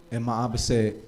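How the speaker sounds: normal talking voice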